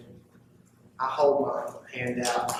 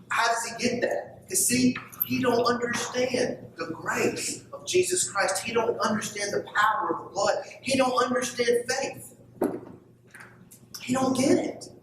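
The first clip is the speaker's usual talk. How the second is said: rising preaching; 9 semitones higher than usual. Acted. Preacher pressing the congregation about grace, fervent rising preaching. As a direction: build the point higher and higher.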